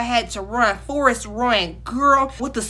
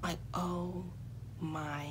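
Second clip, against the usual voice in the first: very soft, low, word by word; hushed dismay; 5 semitones lower, 18 dB quieter, slower than usual. Retold or imitated. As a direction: let each word sink with a long pause. Retold horror at being caught.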